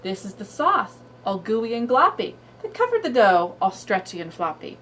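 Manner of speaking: lively singsong read-aloud; reading to a toddler. Shown as a demonstration. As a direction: read it bouncy for a little kid. Reads cumulative story aloud.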